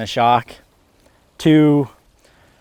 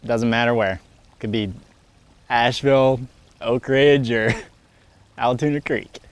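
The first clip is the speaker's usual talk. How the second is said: usual speaking voice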